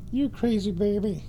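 high, cooing cat tease; pet talk. Real, not acted.

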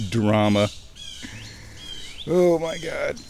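ordinary talk, nothing marked

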